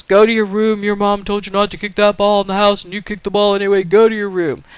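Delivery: loud, insistent dad telling-off; scolding dad. Acted. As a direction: send the kid away as a cross father. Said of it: "Dad kinda looks at it and says".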